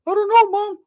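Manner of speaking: very high, loud squeaky whine; mock helpless son. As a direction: whine back at mom in caricature. Imitates cousin answering his mother.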